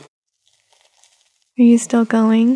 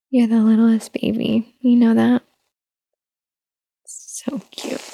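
whispering. Real, not acted. Whispering tenderly to baby.

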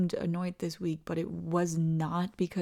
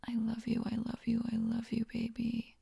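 soft breathy whisper, a touch higher; whispered love; 4 semitones higher, 4 dB quieter than usual. Acted. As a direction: breathe it close to his ear, tenderly.

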